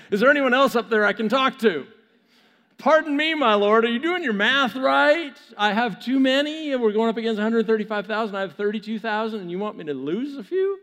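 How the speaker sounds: loud, high-pitched comic protest; incredulous Gideon. Acted. Gideon told he has too many men, incredulous. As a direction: argue upward in acted disbelief. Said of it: "I could just see it again".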